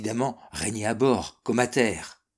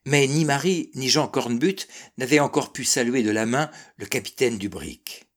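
usual speaking voice

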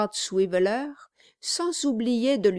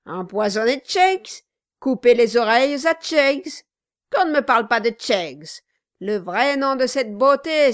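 loud, higher-pitched ranting toast; boisterous outburst; 4 semitones higher, 5 dB louder than usual. A narrator's reading. Dwarf dismissing the rival and toasting her family, exuberant. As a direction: proclaim each phrase with mounting gusto.

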